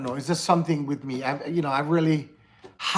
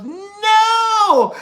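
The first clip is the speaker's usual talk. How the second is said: sudden high yelled no; shouted no; 10 dB louder than usual. Retold or imitated. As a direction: cry out no in shock. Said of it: "she goes".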